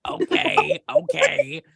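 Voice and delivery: very high, loud squeaky okay okay; mock high voice. Player mimicking her own reaction to being clocked, squeaky and loud. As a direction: squeal it in a put-on tiny voice. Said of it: "I'm like".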